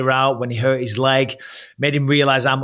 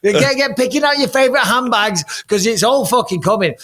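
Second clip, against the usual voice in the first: hyped-up excitement; 8 semitones higher than usual. Pretend. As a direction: pump her up, houses and cars. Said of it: "if you're Tom Aspinall's wife, it's time to get excited".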